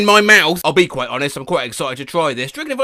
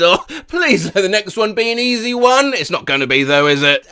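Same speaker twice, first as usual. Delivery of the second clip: ordinary delivery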